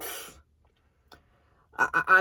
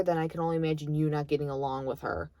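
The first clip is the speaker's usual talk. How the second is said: normal talking voice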